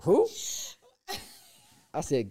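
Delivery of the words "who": high, sassy rising question; Chicago attitude. Retold or imitated. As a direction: imitate her challenging who. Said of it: "with that Chicago attitude".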